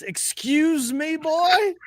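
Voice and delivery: slow, high, affronted Southern drawl; mock-indignant Southern voice; Southern-accented Siri. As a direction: stretch it out as an offended Southern Siri. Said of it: "Siri just".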